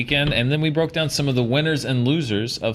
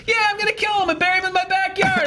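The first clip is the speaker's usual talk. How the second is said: squeaky high cheerful threat; high mock villain; faster than usual. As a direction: rattle off a gleeful threat. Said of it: "But Cucumber's like".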